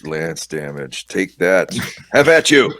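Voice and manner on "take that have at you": loud challenge, rising higher and louder; heroic battle taunt. Acted. Player rolling lance damage, triumphant battle taunt. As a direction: cry it out like a knight landing a blow.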